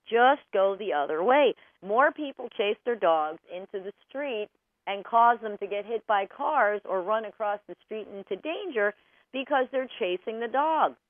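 ordinary delivery

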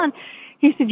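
usual speaking voice

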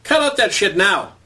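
high, angry command; angry yell. Retold or imitated. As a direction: order the cop to stop, yelling. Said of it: "Frank yelled again".

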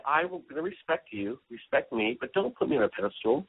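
ordinary talk, nothing marked

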